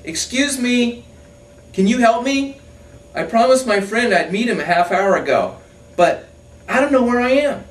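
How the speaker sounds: lost balloonist shouting down. Acted. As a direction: yell it to the ground far below. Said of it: "he shouts".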